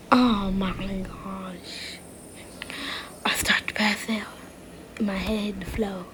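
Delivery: faint dying whisper; fading whisper. Acted. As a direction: let the voice fade as you faint. Character fading, passing out.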